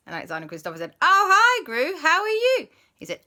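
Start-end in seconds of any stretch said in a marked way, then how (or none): 1.0-2.7 high, loud, eager hello; excited greeting. Acted. The boys greet Gru on the phone, high and excited. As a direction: call out delighted to hear a friend.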